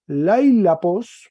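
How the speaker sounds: Lailapos drawn out slowly, loud and high; slow Greek genitive. Shown as a demonstration. Teacher slowly models genitive for class. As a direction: stretch out Lailapos syllable by syllable. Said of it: "mire que cambió la consonante".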